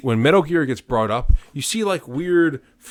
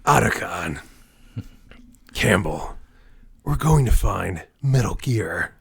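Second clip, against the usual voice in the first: Snake voice; slower than usual. Retold or imitated. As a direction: drag it out like a grizzled agent. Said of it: "let's do, like, one Snake, real good Snake phrase".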